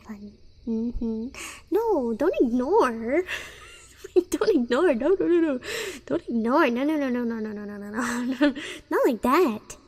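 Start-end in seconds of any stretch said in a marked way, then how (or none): 1.7-8.5 mock panicked protest. Streamer begs chat not to ignore her, mock panicked. 8.9-9.8 higher, loud playful whining; mock indignant protest. Streamer insists chat should get mad, mock indignant.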